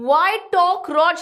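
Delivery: mock indignant retort. Lomov scolding Natalia in the quarrel, slow mock indignation. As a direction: drag out the scolding question.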